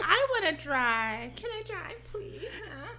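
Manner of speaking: high, drawn-out mock whine; exaggerated pleading. Girl begging to drive the boat, exaggerated high whine. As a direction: beg in a hammed-up squeal. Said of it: "loud and turned up and exaggerated".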